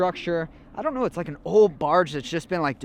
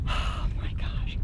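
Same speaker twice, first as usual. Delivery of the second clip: slow whisper of disbelief; awed whisper; 6 dB quieter, slower than usual. Real, not acted. Diver overwhelmed by his catch, whispered. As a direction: breathe it out in awe.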